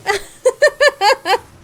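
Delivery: loud squealing laugh; high cackling laugh. Real, not acted.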